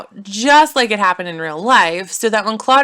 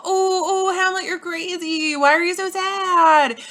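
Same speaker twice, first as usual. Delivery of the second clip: much higher mock fuss; high fake concern; mocking mom and uncle; 11 semitones higher than usual. Mother and uncle to Hamlet, high mock worry.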